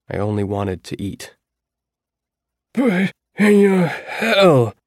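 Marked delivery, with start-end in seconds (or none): 2.7-4.7 put-on high, slow, rasping old man's voice; rough dying voice. A narrator's reading. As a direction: croak it out like a dying man. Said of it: "Percy said in his rough, dying voice".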